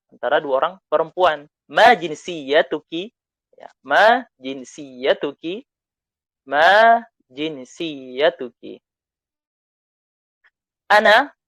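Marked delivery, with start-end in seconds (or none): 1.7-8.8 "Ma jinsiyatuki?" slow and loud, stressed on "ma"; slow model phrase. Shown as a demonstration. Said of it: "Diulang bersama saya". 10.9-11.4 high loud prompting "Ana?"; calling out to class. Shown as a demonstration. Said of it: "teacher calls question to class".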